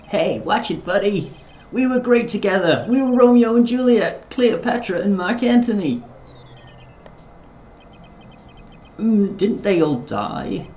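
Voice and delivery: high, loud Yorkshire lad; Yorkshire accent. Acted. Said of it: "I'll do a Yorkshire accent".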